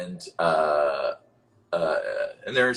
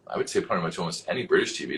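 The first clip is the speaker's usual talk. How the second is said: ordinary talk, nothing marked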